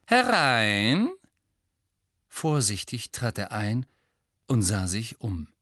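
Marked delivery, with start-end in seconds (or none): 0.0-1.1 high, loud, sickly-sweet call; sugary sweet. A narrator's reading. Umbridge calls Harry in, sugary sweet. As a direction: call him in all false sweetness. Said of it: "rief sie mit zuckersüßer Stimme".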